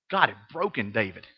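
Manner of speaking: dropped almost to a whisper, stunned; hushed. Real, not acted. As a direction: let it sink in, very softly. Stunned quiet restatement.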